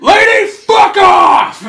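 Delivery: loud, harsh shout; loud retort. Retold or imitated. Yelling at the pushy lady in the cinema, loud shout. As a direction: bark it at her.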